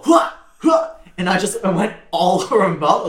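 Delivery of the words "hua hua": bursting sound. Retold or imitated. Imitating the ball bursting in mouth.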